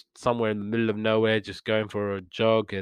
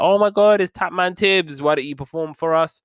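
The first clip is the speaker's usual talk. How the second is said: much higher and louder, gushing with excitement; starstruck old fans; 6 semitones higher, 4 dB louder than usual. Retold or imitated. Old folks in the care home recognising Tapman Tibbs, excited gushing. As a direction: squeal like fans spotting a legend. All the old people are like.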